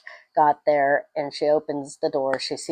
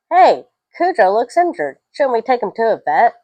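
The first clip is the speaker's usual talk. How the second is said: higher, louder imitated child; kid speaking up; 5 semitones higher, 5 dB louder than usual. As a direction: pipe up like a concerned kid. Voices a kid raising the alarm.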